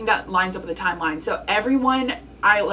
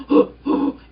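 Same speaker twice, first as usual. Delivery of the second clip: higher, louder nervous gasp; sharp gasp; 6 semitones higher, 6 dB louder than usual. Retold or imitated. Retelling opening the offer email, nervous gasp. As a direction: gasp in mock shock.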